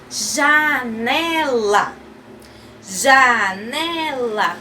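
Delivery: very slow, drawn-out syllables. Shown as a demonstration. Teacher naming a picture for children, syllable by syllable. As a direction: stretch each syllable for learners. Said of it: "vamos falar o nome das imagens".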